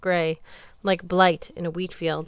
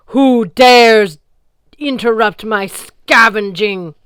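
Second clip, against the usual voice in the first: booming, slow sea goddess voice, much louder; deep baritone goddess; 4 semitones higher, 14 dB louder, slower than usual. A narrator's reading. Sea goddess Ran challenges the boat, booming and deep. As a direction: let the words roll out like a towering sea goddess. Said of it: "She spoke in a deep baritone".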